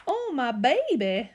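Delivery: distressed mother. Acted. Mama sees her boy stuck, distressed. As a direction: lightly wail it like a worried mama. Mama's cry retold in recap.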